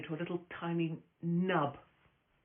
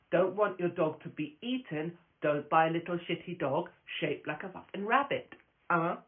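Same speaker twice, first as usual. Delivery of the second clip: normal talking voice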